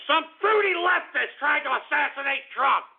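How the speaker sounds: high-pitched ranting yell; outraged. Real, not acted. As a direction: burst out in outrage, pitch jumping up. Still upset, repeats it in outrage.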